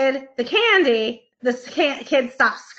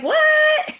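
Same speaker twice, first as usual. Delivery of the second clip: put-on shocked kid; 3 dB louder than usual. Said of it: "The kid is gonna be like".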